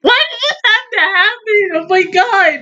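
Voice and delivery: high wail sliding downward; dismayed wail. Real, not acted. Viewer laments what happened in the episode, dismayed wail.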